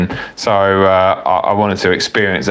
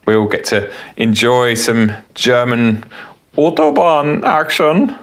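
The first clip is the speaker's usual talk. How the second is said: jokey fake German accent for a German viewer; bad German accent; 3 semitones higher than usual. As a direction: ham up a cheesy German accent on Autobahn action. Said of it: "such a bad accent".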